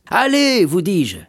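high, loud "allez"; insistent urging. Acted. Shipowner insists the young sailor go, insistent. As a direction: jovially order him off.

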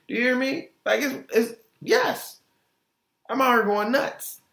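high-pitched, squealing with delight; giddy excitement. Pretend. Imagining a chart hit, pitched high with glee. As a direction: bounce up high like overjoyed.

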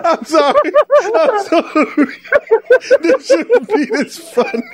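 high-pitched giggling through words; laughing so hard the voice climbs. Real, not acted. Can't stop laughing.

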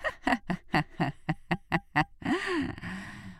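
high, long jackal snicker; staccato sly giggle trailing off; sneering cackle. A narrator's reading. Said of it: "ricana le chacal". (ricanement aigu du chacal)

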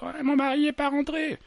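high, mocking wife on the phone; a wife calling the police; worried wife. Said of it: "Premier appel à la police". (épouse au téléphone, voix aiguë moqueuse)

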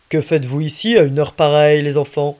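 put-on a policeman quizzing two kids out late; stern policeman. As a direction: demand answers like a cop on patrol. Policeman questioning the children.

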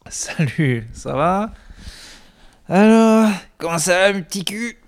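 lightly done comic hobo voice; Douli voice. Retold or imitated. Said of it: "C'est une imitation de Douli".

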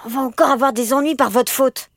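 high, loud whiny griping; grumbling complaint. A narrator's reading. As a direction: moan at them for causing trouble. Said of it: "râla Jules".

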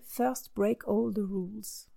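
slow, careful English accent on the title, with a French speaker's self-mockery; a showy English accent, said with a wink at herself; self-mocked English accent. Shown as a demonstration. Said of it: "avec mon magnifique accent".

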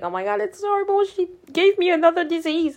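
high, rushed dramatic complaint; mock panic. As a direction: exaggerate your dismay in a high voice. Retells own panic over pain.

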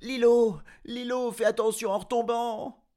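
high, loud calling out; shouting after him. A narrator's reading. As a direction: call to him across the fields. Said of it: "en criant".

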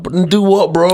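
high incredulous retort. Retold or imitated. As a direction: throw it back in disbelief. Retelling own reply to advice.

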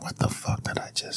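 hushed, voiceless whisper of regret. Retold or imitated. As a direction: whisper it like waking up ashamed. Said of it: "you're like".

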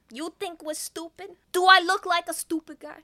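loud, threatening wiseguy; menacing mobster, very loud on the threat; tough mobster. Acted. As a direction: lean in like a thug. Thug voice in the car.

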